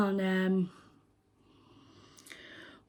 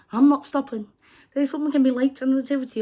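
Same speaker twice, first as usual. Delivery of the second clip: louder, higher and fast, dead set; adamant; 5 semitones higher, 6 dB louder, faster than usual. Retold or imitated. Speaker refusing to move for the late woman, adamant and louder. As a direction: refuse to budge an inch. Retelling own stubborn stance.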